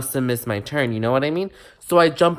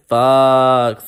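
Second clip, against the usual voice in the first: sudden louder swear; loud curse; 8 dB louder than usual. Retold or imitated. Student cursing her own embarrassing mistake, loud. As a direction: blurt it out.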